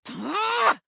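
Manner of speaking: sharp high-pitched cry of rage; frustrated yell. Acted. Rubber duck blowing up at the player, high angry yell. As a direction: let out a short furious yelp. Duck erupts before yelling get out.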